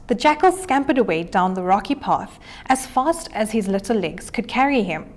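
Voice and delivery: ordinary delivery, nothing marked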